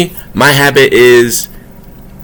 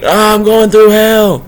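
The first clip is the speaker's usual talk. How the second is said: high, slow, whiny mock lament; mock complaining; 9 semitones higher, slower than usual. Mocking someone who thinks life is hell, high and whiny. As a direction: ham up the self-pity, stretched out. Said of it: "you down here sitting here like".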